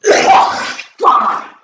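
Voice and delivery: sudden loud curse after coughing; real exasperated yell; frustrated shout. Real, not acted. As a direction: bark it out, fed up. Cough fit, frustrated outburst.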